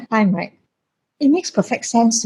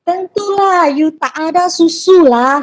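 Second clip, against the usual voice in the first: higher, scolding auntie nurse voice; mock-scolding nurse, pitched up; 8 semitones higher, slower than usual. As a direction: mimic a nagging old nurse clucking at the new mother. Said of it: "And she was like".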